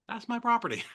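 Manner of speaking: high-pitched mock outrage, voice jumping up; startled landowner. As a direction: play a landowner caught off guard. Acts startled landowner discovering building.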